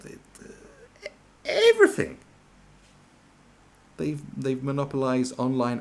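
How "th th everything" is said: exaggerated. Real, not acted. Stretching Google's reach.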